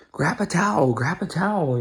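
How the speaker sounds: lightly voiced grandma calling out instructions; urgent grandma. Grandma to grandson during the grease fire, urgent.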